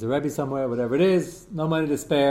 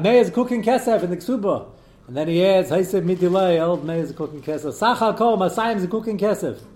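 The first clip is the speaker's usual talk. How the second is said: raised, louder recitation of a formula; reading text aloud; 6 semitones higher, 8 dB louder than usual. Shown as a demonstration. Teacher reciting the Kesuba wording to his class, raised formal recitation. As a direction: read it out loud and high, like a document.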